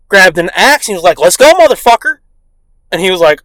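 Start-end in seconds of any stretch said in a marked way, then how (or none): 1.2-2.2 loud, raised-pitch dare; mock fighting challenge. Dad with an axe to neighbor, mock fighting challenge. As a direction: imitate a dad ready to brawl.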